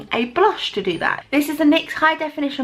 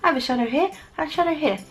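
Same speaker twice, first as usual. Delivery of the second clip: normal talking voice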